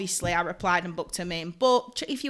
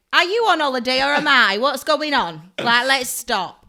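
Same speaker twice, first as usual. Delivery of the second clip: raised, loud incredulous questioning; mock exasperation; 3 semitones higher, 6 dB louder than usual. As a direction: imitate an exasperated apprentice, loud and higher. Quoting exasperated apprentice's challenge.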